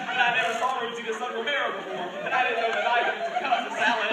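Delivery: ordinary talk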